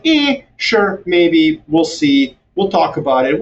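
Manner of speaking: high drawn-out opening, then casual hedge; noncommittal hedging. Acted. Independent countries answering Washington's loyalty tests, noncommittal hedging. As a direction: shrug it off without committing.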